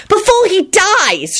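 high, drawn-out melodrama; dramatic. A narrator's reading. Ginny presses Lupin to save George, dramatic. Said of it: "she added dramatically".

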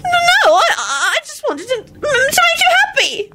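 high, tearful, stammering whine; pleading, childlike high whine; whining. Acted. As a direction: whimper it in a very high voice. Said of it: "Loretta whined".

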